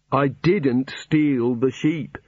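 slow, deliberate stress on "didn't"; drawn-out protest with "didn't" stressed; emphatic denial. Acted. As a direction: insist word by word, leaning hard on "didn't". Prisoner insists on his innocence.

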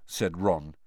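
usual speaking voice

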